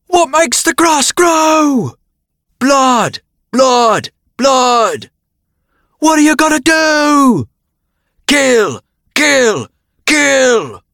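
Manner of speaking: high, loud aristocratic British battle cry, drawn out; mighty British bellow. A narrator's reading. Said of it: "With a mighty bellow, he shouted in with an aristocratic British accent".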